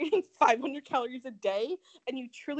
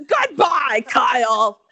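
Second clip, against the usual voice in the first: shouted catchphrase; 12 dB louder, much slower than usual. Retold or imitated. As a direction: yell the famous line. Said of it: "is the new".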